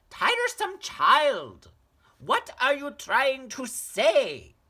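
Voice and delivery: very high, loud, impatient mother; scolding mother. Acted. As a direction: snap at a tiresome child.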